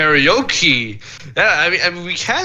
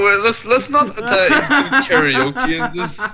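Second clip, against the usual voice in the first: laughing plea; 5 semitones higher, slower than usual. Real, not acted. Pleading through laughter.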